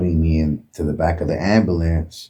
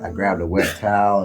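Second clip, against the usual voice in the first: ordinary talk, nothing marked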